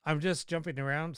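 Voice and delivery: ordinary talk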